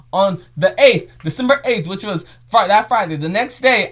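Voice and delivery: high, loud and slow, building with excitement; excited announcement. Real, not acted. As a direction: build it up and burst out about the trip.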